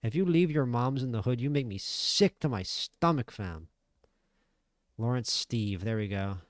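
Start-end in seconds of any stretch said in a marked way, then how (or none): 0.0-3.7 mock trash talk. Imitating a Canadian fighter calling out an opponent, loud mock disgust. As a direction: sneer it like a fighter calling out a rival.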